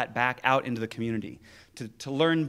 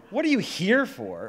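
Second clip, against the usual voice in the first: HERE punched high in "What are you here for?"; higher pitch, hit the word HERE hard; stress on a different word; 5 semitones higher, 4 dB louder than usual. Shown as a demonstration. Showing listeners how to emphasize a different word, HERE stressed. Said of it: "each time emphasize a different word".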